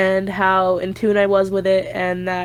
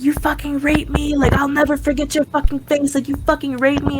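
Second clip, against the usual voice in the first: retold screaming in a raised, high voice; mock scream; 7 semitones higher than usual. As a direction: imitate someone screaming accusations, pitched up at normal volume. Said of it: "fucking screaming at him".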